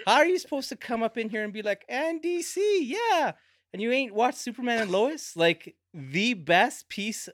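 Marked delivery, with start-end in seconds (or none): 1.9-3.3 high, put-on cheer; mocking imitation. Scolding a cohost by aping his show chant, mocking. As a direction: mimic him in a much higher voice.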